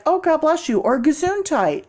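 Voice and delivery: a light imitation of a kindly stranger; lightly mimicked courteous passerby; polite stranger. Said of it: "most people are like".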